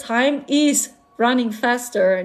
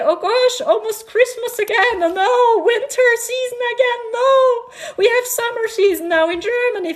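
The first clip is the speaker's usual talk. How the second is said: much higher, hammed-up whining about the seasons; mock dismay; 10 semitones higher than usual. Telling viewers winter comes too soon, high mock whine. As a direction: play it up high like a comic lament.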